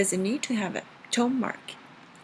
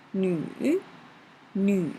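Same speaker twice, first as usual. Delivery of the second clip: bend "Nu" down, then up, drawn out; third tone. Shown as a demonstration. Teacher practising the word for daughter, dipping-rising "Nu". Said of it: "well tone".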